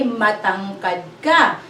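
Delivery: Matangkad ka said slowly and clearly; slow model. Shown as a demonstration. Teacher slowly gives the right Tagalog phrase. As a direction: spell out Matangkad ka slowly for the class. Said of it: "We say".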